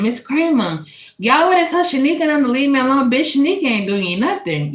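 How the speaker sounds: high-pitched, rushed complaint to the teacher; tattling girl. Retold or imitated. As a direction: act out an indignant classmate telling on someone.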